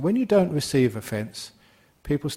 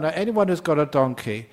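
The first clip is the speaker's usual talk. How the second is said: usual speaking voice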